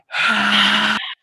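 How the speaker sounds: long, loud emptying breath out; sharp whooshing sigh to empty the lungs; forced exhale. Shown as a demonstration. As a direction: push all the air out audibly. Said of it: "Out everything".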